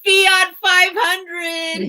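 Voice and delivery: slow, loud, high proclamation of Fiat; proud slow announcement of her toy Fiat; drawn-out announcement. Real, not acted. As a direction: stretch it out like a big reveal. Announcing the car model.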